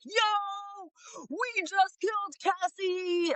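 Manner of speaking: high slow mock confession; imitating Brian. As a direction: play the clueless killer bragging on tape. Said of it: "Brian is on tape, literally like".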